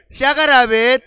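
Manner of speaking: ordinary talk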